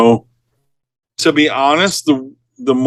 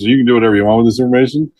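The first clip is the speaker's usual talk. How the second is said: fast aside; much faster than usual. Retold or imitated. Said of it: "grab a hold of one of his buddies and goes".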